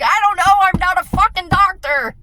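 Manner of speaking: retold outburst, loud and high; exasperated yell. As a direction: bark it out, fed up. Retelling exasperated outburst at someone.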